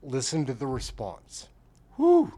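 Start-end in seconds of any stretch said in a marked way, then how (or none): 2.0-2.3 long whew. Real, not acted. Reacting to a dodged answer.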